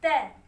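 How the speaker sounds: द said short as "duh". Shown as a demonstration. Teacher to students, द as short "duh". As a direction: say द as a short "duh" before a consonant. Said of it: "इसका pronunciation होता है हमारा".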